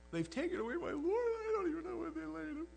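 high, thin grieving voice; distraught Mary. Retold or imitated. Mary telling the angels, high and grieving. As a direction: lightly voice a woman near tears. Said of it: "And she said".

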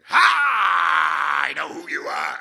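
loud, harsh demonic "Ha!"; demon shout. Retold or imitated. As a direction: bark it like a possessed man. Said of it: "it was one of those voices".

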